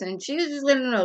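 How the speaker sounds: normal talking voice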